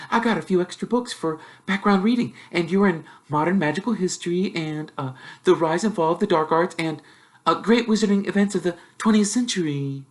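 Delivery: lightly voiced swot, brisk and pleased; know-it-all girl. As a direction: reel off facts proudly. Hermione voiced by narrator.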